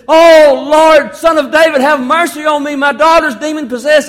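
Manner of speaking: loud, high-pitched pleading; acted desperate plea, nearly shouted; desperate cry. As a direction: call out like a desperate mother. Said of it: "here comes this desperate woman".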